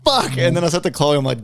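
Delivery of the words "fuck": sharply raised exclamation of disbelief; wigged out. Retold or imitated. Man retelling a stranger walking past, wigged out. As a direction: re-enact the freak-out, pitch jumping up. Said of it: "fucking wigged myself out".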